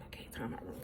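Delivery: whispered to herself; unvoiced, muttered aside; under breath. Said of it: "So I'm like".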